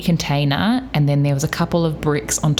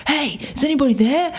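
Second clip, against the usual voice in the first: bold call out; 11 semitones higher than usual. Shown as a demonstration. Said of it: "it should be more like".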